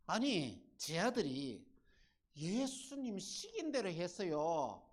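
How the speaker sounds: indignant protest. Acted. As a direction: protest in disbelief at how unfair it is. Voicing the disciples' indignant protest.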